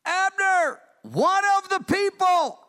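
high, loud call across a valley, slow on the second part; David hailing Abner from the hilltop. Retold or imitated. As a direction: shout the name to someone far off.

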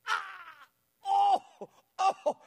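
high, loud drawn-out "ah, oh, oh"; loud pained wails; cry of pain. Retold or imitated. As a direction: re-enact waking up howling in pain. Waking in agony, retold.

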